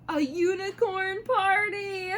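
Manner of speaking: high, loud and slow, a dramatic cry; excited exclamation. A narrator's reading. Mom reading a unicorn story to kids, loud excited cry. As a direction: announce the unicorn party like a disaster.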